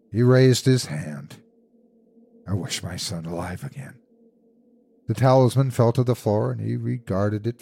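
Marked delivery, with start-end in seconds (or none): 2.5-4.0 soft, reluctant utterance; subdued wish. A narrator's reading. Husband reluctantly wishes his son alive, subdued. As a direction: say it low in volume, reluctantly.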